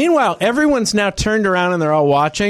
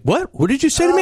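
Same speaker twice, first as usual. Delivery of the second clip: high-pitched incredulous question; taken aback; 11 semitones higher than usual. Retold or imitated. Seatmate reacting to being called dishonorable, taken aback. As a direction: mimic someone stunned by an insult. He seemed really, really, like, surprised.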